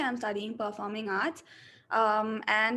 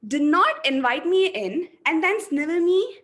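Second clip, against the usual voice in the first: louder, higher, emphatic delivery; energetic, forceful reading; 5 semitones higher, 5 dB louder than usual. Shown as a demonstration. Acting student reads the second version, louder and higher. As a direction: push every line out with energy. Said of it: "So I'll go with the second one".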